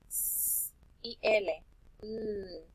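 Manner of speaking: slow, drawn-out letter sounds S and L; sound demo. Shown as a demonstration. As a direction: stretch out "S y L" slowly, letter by letter. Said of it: "las sílabas con S". (sonidos de letras alargados)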